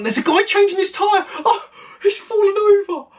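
high-pitched, excited cry; alarmed amazement. Real, not acted. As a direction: squeal it, watching a mishap unfold. Reacting to man falling over.

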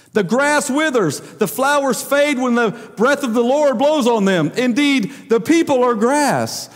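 preaching, slowing. Acted. Preacher reading Isaiah's answer to the congregation, slow and lifted. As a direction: let each phrase land like a proclamation. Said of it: "That'll preach".

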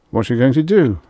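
high, loud, alarmed question; terrified. A narrator's reading. Rye asking Extroni about the rope, terrified. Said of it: "Rye asked, terrified".

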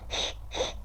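breathy shh to calm himself; soft shushing. Shown as a demonstration. Gamer shushing himself to calm down after dying, soft shh. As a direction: hush yourself gently. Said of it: "Sakin ol".

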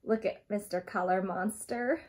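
ordinary delivery

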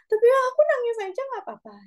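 put-on high child voice; child's voice. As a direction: rush it out like a small kid. Parent voicing child.

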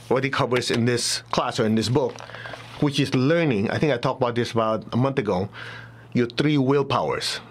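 usual speaking voice

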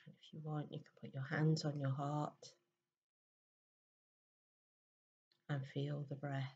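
very soft, unhurried meditation voice with long pauses; guided meditation. Real, not acted. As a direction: gently guide listeners into stillness, hushed and even.

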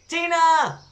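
put-on high, loud child's shout; shouting at the top of her voice. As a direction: call up to the seventh floor. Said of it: "shouted Zoe at the very top of her voice".

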